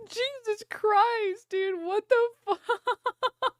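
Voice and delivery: high and slow, stretched through laughter; laughing disbelief. Real, not acted. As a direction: drag it out, still cracking up.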